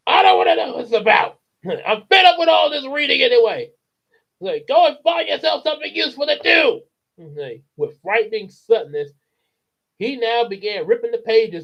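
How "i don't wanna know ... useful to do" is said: high loud fast rant; barked rant. Acted. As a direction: snap it at her angrily. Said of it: "Mister Wormwood barked".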